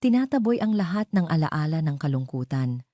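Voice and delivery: ordinary delivery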